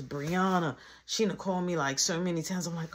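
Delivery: ordinary delivery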